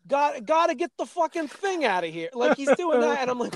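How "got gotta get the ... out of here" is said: a hint of a Brooklyn wiseguy; Brooklyn accent. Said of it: "they give him this, like, Brooklyn accent".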